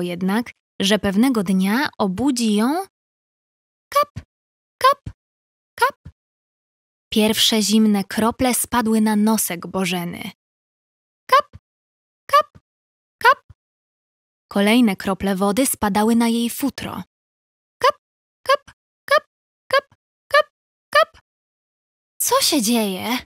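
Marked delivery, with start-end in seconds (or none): 3.9-6.1 squeaky high drip sounds, one by one; playful high dripping noises; high raindrop plinks. Acted. 11.3-13.4 squeaky high drip sounds, one by one; playful high dripping noises; high raindrop plinks. Acted. 17.8-21.2 squeaky high drip sounds, one by one; playful high dripping noises; high raindrop plinks. Acted.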